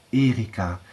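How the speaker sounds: usual speaking voice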